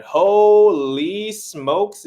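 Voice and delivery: loud, drawn-out burst of amazement; excited exclamation. Real, not acted. Caster spotting the map, excited exclamation.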